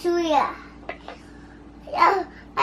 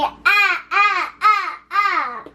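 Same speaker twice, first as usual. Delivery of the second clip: alligator growl; 5 semitones higher, slower than usual. Pretend. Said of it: "They beat your finger, say".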